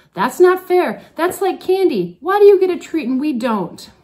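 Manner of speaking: high, loud protesting wail; mock student outrage. Teacher imitating her screaming advisees, loud and high. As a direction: whine it out like a class of angry teens. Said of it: "twenty-four voices were screaming at me".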